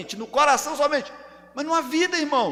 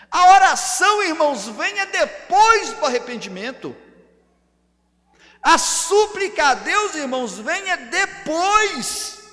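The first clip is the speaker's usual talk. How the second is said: loud, high declaration leaning on "depois"; emphatic preaching; 4 semitones higher, 6 dB louder than usual. Real, not acted. Preacher telling church that prayer follows repentance, emphatic preaching. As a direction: hammer the point home. Preacher hammering the order of things.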